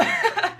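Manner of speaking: quick high-pitched giggle. Real, not acted. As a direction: let a short giggle escape. Laughing at own joke.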